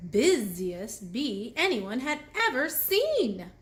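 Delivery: ordinary delivery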